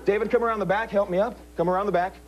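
slow, drawn-out stiff and rigid; hypnotic command. Acted. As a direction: stretch out each command. Repeated rigidity suggestion.

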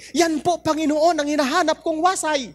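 high, overjoyed cry of thanks; the woodcutter bursts with joy at his lost axe; jumping for joy. Acted. Woodcutter overjoyed as the Lord returns his own axe, high joyful cry. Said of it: "Nagtalon sa tuwa".

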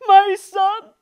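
high, slow lament; grieving cry. Acted. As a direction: cry out the loss. Grieving king.

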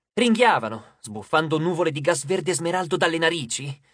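ordinary delivery

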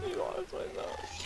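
slow, whispered disbelief; hushed, dumbfounded whisper; stunned whisper. Real, not acted. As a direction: breathe the words out, too shocked for voice. Repeats in disbelief after loss.

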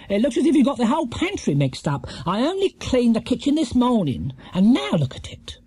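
high-pitched grim complaint. A narrator's reading. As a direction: tell them off for the mess. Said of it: "said Missus Bird grimly".

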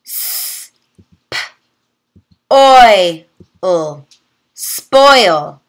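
"S, p, oi, l" said as separate sounds, then blended into "spoil"; sounding out. Shown as a demonstration. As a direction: segment each phoneme, then blend the word. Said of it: "Let's practice together".

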